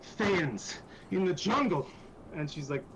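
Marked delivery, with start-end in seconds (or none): 0.0-1.9 raised, drawn-out cry; frustrated exclamation. Acted. Iku rails at Thayans in the jungle, frustrated. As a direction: exclaim it slowly in acted exasperation.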